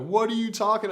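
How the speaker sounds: high, loud retold panic; imitated freaking out. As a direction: act out a partner freaking out. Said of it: "she starts crying, freaking out".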